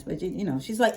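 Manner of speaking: ordinary talk, nothing marked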